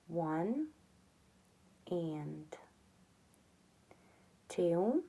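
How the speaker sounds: quiet murmured count under the breath; soft counting. Real, not acted. Counting stitches for crochet viewers, softly murmured.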